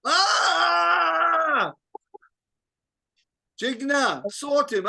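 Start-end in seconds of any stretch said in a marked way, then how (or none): 0.1-1.7 sudden high loud "Ah!" of exasperation; a sharp yelp when the reader is lost; exasperated cry. Real, not acted. Teacher reacting to a lost reader, exasperated high cry.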